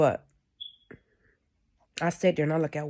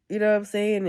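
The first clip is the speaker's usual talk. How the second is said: usual speaking voice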